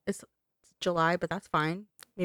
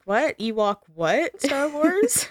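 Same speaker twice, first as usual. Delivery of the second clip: confused surprise; 7 semitones higher, 4 dB louder, much slower than usual. Pretend. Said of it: "They're like".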